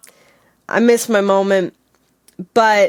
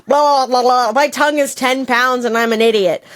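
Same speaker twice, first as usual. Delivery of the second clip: ordinary talk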